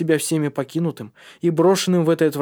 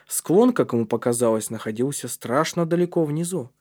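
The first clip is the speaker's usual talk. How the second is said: plain reading voice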